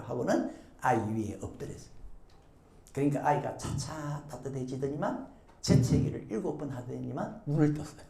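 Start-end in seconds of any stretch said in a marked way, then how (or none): none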